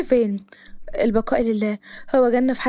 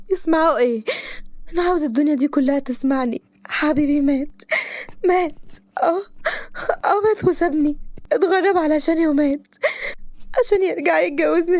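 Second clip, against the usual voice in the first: high tearful wail; crying out; 4 semitones higher than usual. Acted. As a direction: let everyone hear her sob. Hysterical grief crying.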